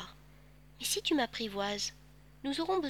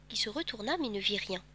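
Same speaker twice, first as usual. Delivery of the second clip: ordinary delivery, nothing marked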